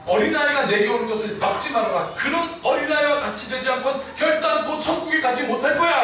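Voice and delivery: stern warning climbing high in pitch; forceful preaching. Acted. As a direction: deliver the quote as an emphatic admonition. Preacher voices Jesus' stern warning.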